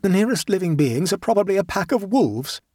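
high, dramatic self-talk; dramatic exclamation. A narrator's reading. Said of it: "he exclaimed dramatically to himself".

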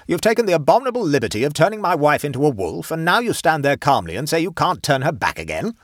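loud, high indignant tirade; outraged shouting. A narrator's reading. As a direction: berate him as an outraged husband. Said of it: "shouted Colonel Hampton".

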